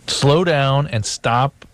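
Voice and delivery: stretched, very slow words. Real, not acted. Co-host asking the host to slow down, very slow. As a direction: drag each word out to make the point. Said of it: "I want you to slow down".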